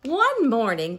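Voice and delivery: ordinary delivery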